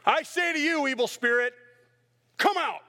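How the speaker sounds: high, loud pompous command; mock exorcism. Philip grandly commanding the spirit, loud and high. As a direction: proclaim it like a showy exorcist.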